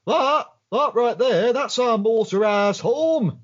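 slow, high, affronted; indignant Max. Retold or imitated. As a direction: spell out each word like it's obvious. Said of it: "Max just sort of like".

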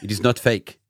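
put-on French rugby expert; hammed-up French accent, proud and emphatic. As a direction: read it in a thick comic French accent. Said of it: "you know an accent's coming".

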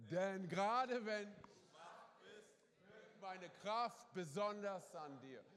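slow and loud, every word weighed; slow emphatic reading. Acted. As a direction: proclaim it slowly to the church. Reads key Bible verse aloud.

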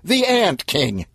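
stunned outcry; outraged repeat. Acted. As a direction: hammer the name in shock. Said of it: "yelled Stan".

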